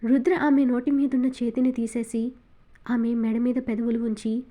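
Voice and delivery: plain reading voice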